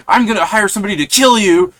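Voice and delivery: screamed threat. Retold or imitated. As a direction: scream the threat at the guy who took your chair. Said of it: "she also screamed that".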